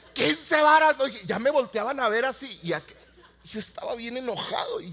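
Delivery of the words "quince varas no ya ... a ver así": ordinary talk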